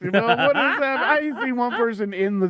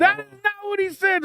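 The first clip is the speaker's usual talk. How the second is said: very high, indignant denial; upset grandma. Retold or imitated. Mother disputing what the toddler said, upset. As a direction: imitate an outraged mother, pitched way up. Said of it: "Why are you getting so upset?".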